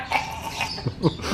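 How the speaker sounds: voiceless gagging on cheese; hammed-up choking noise; mock choking. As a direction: imitate suffocating, dry throaty choke. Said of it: "suffocating with the cheese like".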